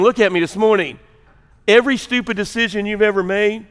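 normal talking voice

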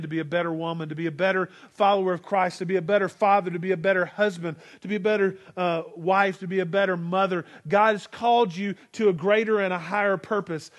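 ordinary talk

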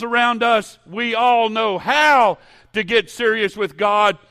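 high, very loud pulpit shouting; full-force preaching. Real, not acted. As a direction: drive each phrase home at full volume.

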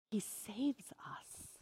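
slow, hushed whisper. Acted. Pastor telling the congregation softly, whispered. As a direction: breathe it out softly, slowly.

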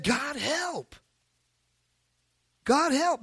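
usual speaking voice

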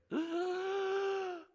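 high gasp of a first breath; sharp gasp. Acted. Dead girl coming back to life, a sharp gasp. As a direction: suck in air as life returns. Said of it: "she takes a deep breath".

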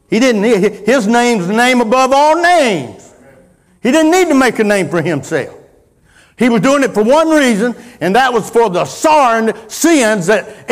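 loud, high preacher's delivery; full-force preaching. Real, not acted. Preacher telling the church why Christ suffered, full-force preaching. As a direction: drive it home to the congregation, loud and high.

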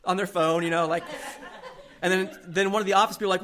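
ordinary talk

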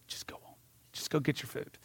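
hushed, breathy whisper leaning out a window; whispered aside. Retold or imitated. Drive-thru lady whispering to the driver, hushed. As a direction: slip him the secret in a whisper. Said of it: "kinda leans out, and she just goes".

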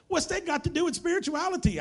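high-pitched incredulous question; imitated disbelief. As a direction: object as a puzzled outsider. Acts out baffled Thai listener objecting.

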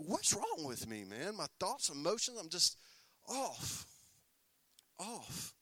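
troubled, trailing inner voice; mock despair. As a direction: let it fade away into nothing. Imitating an oppressed person.

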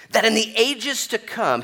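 That lifted high and loud, in stressed; proclaimed loud. Shown as a demonstration. Preacher rereading the verse to the crowd, loud and high. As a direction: ring out That in the ages to come. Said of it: "it could be in verse seven".

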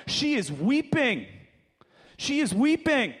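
high, drawn-out dramatic cry of a storyteller; dramatic storytelling. Acted.

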